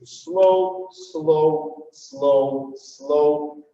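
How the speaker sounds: evenly spaced, sustained SLOWs; full-beat count. Shown as a demonstration. Dance teacher counting full beats, steady and measured. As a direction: give every SLOW the same full beat. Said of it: "Full beat".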